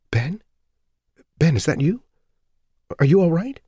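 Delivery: wife asking her husband if he's all right; worried wife. Acted. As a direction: reach for him with worry.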